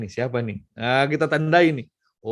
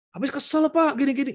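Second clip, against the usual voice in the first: high, whiny excuse; high-pitched fed-up mother; complaining mother; 11 semitones higher than usual. Retold or imitated. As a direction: whine the excuse like a fed-up mum. Mother excusing her scolding.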